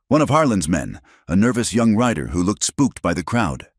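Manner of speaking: ordinary delivery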